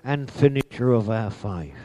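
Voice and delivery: high, lifted preaching line. Acted. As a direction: lift it up to the congregation. Preaching surge.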